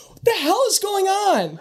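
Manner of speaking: high shrieking scream. Acted. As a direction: shriek in shock. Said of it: "who screams".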